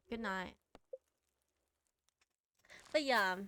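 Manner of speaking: normal talking voice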